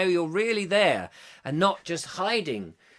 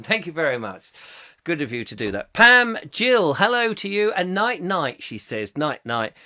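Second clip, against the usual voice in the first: ordinary talk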